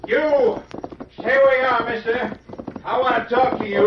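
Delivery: hollering across the trail; angry shouting. Acted. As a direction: call out angrily to stop him. Angry guide confronts masked man.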